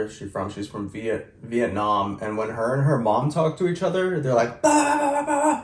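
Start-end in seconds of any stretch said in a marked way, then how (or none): 4.6-5.6 high-pitched imitated yelling gibberish; mock foreign-language yelling. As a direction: mimic a heated argument in high nonsense syllables. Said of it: "It's like they're yelling at each other".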